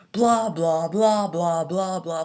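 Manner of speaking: mocking Big Show's rambling. Big Show drones on in promo.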